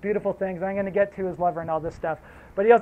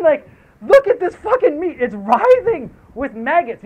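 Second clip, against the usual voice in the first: acted angry outburst; 7 semitones higher, 7 dB louder than usual. Angry prisoners confront the captain.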